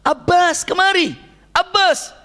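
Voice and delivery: high, joyful call to Abbas; glad call. Retold or imitated. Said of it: "Rasulullah langsung gembira". (memanggil Abbas dengan gembira dan nada tinggi)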